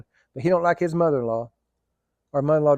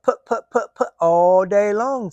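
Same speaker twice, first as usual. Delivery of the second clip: engine putter; 4 semitones higher, 5 dB louder, slower than usual. Retold or imitated. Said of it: "it'd go".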